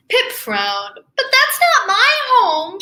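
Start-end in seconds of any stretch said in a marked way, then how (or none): 1.2-2.8 high little voice insisting it is not home; small high penguin objection; penguin protest. Acted. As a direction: raise the pitch and push back on not. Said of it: "she said".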